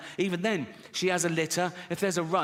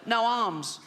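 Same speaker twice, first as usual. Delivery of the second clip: put-on higher, strained little boy voice; Timmy's funny little voice; 6 semitones higher than usual. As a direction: squeeze it out like a small boy with a bad chest. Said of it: "a funny little voice".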